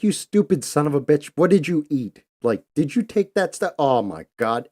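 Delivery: high, loud scolding in disbelief; exasperated yelling. Retold or imitated. Buddy yells at his tripping friend, loud exasperated scolding. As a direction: chew him out, half furious, half stunned.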